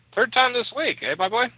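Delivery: high-pitched needling question; teasing father. A narrator's reading. Father ribbing his wounded son.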